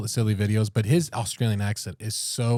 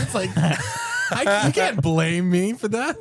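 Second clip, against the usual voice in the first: laughing; 6 semitones higher, slower than usual. Pretend. Imitating accent-speaking singer.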